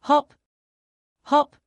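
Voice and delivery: high, loud bouncy calls; bouncy hop. Pretend. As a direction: call it out with a bounce. Rabbit hop sound effect.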